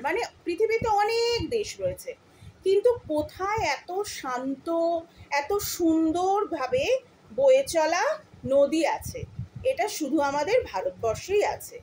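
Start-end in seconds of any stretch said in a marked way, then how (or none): none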